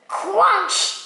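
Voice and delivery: high, emphatic exclamation; big crunch. A narrator's reading. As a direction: punch out the word like a stomp. Acting out crunching the ice.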